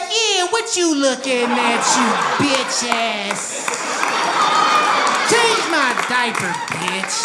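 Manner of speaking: slow, mock-tough toddler voice, shouting; tough little baby. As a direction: play a baby talking trash. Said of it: "He'd be like".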